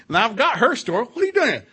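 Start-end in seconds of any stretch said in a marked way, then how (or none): 1.1-1.7 high, loud incredulous question; retold exasperated demand. Preacher retelling his talk with the hiding husband, exasperated demand. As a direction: demand it of him, pitched way up.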